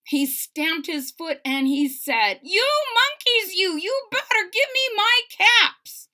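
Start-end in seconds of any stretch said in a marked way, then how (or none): 2.4-6.1 high loud furious threat; quite angry peddler. Acted. Peddler stamping his foot at the monkeys, high furious shout. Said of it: "Now he felt quite angry".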